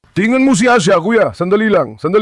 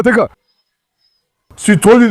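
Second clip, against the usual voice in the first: ordinary delivery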